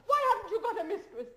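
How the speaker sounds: loud, high mocking taunts with laughter; taunting laugh. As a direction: goad him with laughing scorn. She taunts him, laughing.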